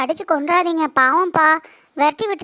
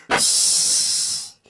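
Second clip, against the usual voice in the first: snake hiss; much slower than usual. Acted.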